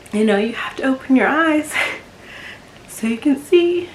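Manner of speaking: choked up, voice rising high; real emotion welling up. Real, not acted. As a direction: fight back tears as the pitch climbs. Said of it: "gonna get a little emotional".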